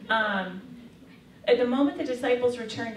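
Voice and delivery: ordinary talk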